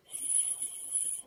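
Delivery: the letter "S" as a long unvoiced hiss; snake-like S sound. Shown as a demonstration. Teacher models the first sound for pupils, snake-like hiss on "S". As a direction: say "S" as one drawn-out sss, like a snake. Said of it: "like snake".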